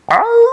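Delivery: long, high, loud wolf howl. A narrator's reading. Wolf howling at the lost lamb from the darkness, long howl. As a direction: howl out and hold the note high. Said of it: "she heard from the darkness this sound".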